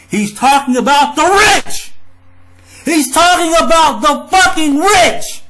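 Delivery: loud, high, furious rant; yelling at the rich, fired up; riled up. Real, not acted. Ranting at viewers about the rich, loud and furious. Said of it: "I'm riled up".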